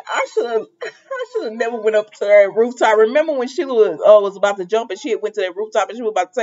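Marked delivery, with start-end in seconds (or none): 0.1-3.0 quoting through giggles; retelling a funny line, laughing; laughing quote of Taylor. Retold or imitated. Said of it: "Taylor tickled me".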